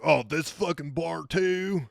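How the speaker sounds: high, drawn-out oafish complaint; imitating a disgruntled American oaf; mock angry outburst. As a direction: whine it slowly like a huffy tourist. Retold tourist storms out, again.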